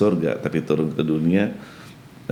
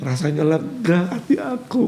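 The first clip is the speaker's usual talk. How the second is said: put-on lightly raised child voice, relieved; a hint of a relieved little girl; child's voice; 12 semitones higher than usual. Grandchild telling her grandfather how she feels, lightly childlike.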